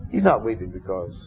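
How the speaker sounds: normal talking voice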